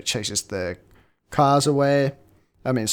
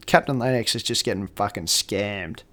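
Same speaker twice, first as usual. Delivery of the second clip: ordinary delivery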